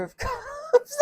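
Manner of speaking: words breaking into real giggles; can't keep a straight face; laughing through it. Real, not acted. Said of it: "I can't take myself seriously right now".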